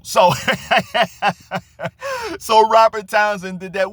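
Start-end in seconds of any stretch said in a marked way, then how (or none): 0.4-2.3 a real laugh jumping way up high; real high falling laugh; high burst of laughter. Real, not acted. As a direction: crack up at the joke. Laughs at his own story.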